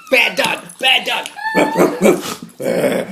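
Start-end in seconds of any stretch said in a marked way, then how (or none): 1.5-2.6 high, slow woof noises; dog bark imitation. Mimics the dog barking.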